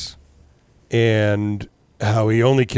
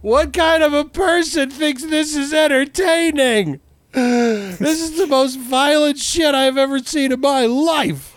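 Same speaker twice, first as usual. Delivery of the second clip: much higher, slow, aghast mock voice; horrified girlfriend; 14 semitones higher than usual. Voicing Tiffany's horror at the violent show, high mock outrage. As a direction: play her shocked disgust, pitched way up. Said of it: "Which is basically".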